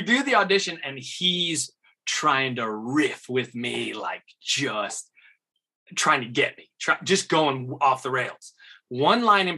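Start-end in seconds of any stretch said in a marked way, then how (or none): none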